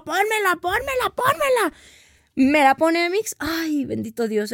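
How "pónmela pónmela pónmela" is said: each "pónmela" climbing much higher; frantic pleading; desperate begging. Retold or imitated. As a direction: plead urgently for the epidural.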